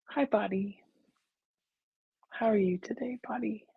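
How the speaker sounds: hushed, near-whispered hello; soft, tender greeting. Shown as a demonstration. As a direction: greet gently, fading toward a whisper. Gently greets the body in meditation.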